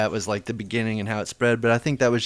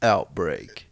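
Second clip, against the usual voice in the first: movie trailer voice; 4 semitones lower, 5 dB quieter, slower than usual. Shown as a demonstration. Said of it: "you have to do the movie voice".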